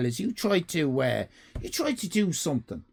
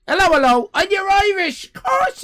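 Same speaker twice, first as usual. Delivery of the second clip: much higher, loud delighted hello; excited greeting; 8 dB louder than usual. Acted. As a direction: greet a long-lost countryman. Greeting a fellow Irish viewer.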